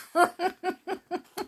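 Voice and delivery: high, rapid giggle; high laugh. Real, not acted. Reader after finishing the story, high giggle. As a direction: giggle at your own punchline.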